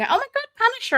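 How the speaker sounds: ordinary talk, nothing marked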